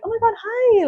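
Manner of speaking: high, gushing fake-friendly hello; fake sweet greeting. Vlogger mocking coworkers' fake sweet hi. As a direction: mimic the over-sweet greeting of two-faced women. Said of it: "people just be like".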